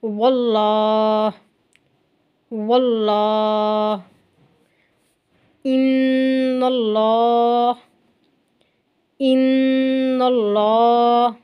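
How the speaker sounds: वल्लाह and इन्नल्लाह with a thick, dark ल, slow and drawn out; slow tajweed recitation with dark lam; heavy lam. Shown as a demonstration. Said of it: "इसको मोटा करके पढ़ेंगे".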